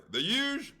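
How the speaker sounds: high squeaky imitation of the deli clerk; mock deli worker. The deli clerk calling out her question, squeaky and high.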